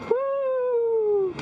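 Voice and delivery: high gleeful woo; excited whoop. Real, not acted. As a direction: whoop with delight. Flying back up like Superman.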